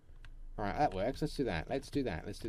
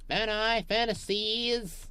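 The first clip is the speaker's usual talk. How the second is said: playful high fantasies; 10 semitones higher, 5 dB louder, slower than usual. Pretend. Word play.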